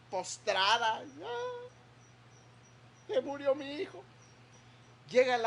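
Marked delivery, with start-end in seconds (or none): 1.2-4.0 high, soft wail of a grieving mother; a mother crying over her dying son; mock lament. Hagar voiced lamenting her son.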